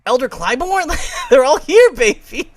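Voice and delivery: high, loud, laughing glee; giddy cheer, laughing through it; excited. Real, not acted. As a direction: squeal it like a thrilled fan. Excited listing of cast.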